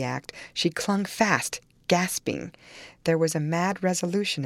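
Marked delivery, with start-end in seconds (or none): none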